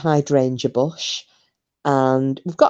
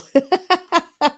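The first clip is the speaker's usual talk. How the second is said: high-pitched burst of laughter; real laugh; 13 semitones higher, 3 dB louder than usual. Real, not acted. Streamer teasing Paul as a drunken tosser, laughing. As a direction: laugh in a high run of pulses.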